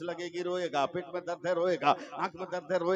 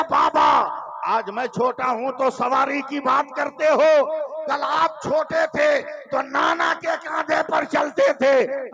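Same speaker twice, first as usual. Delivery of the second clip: high, loud impassioned cry; 7 semitones higher, 12 dB louder, slower than usual. A narrator's reading. Little Asghar answering his father, loud impassioned cry. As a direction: cry it out with fervour. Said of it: "फिर रोते रोते कहा".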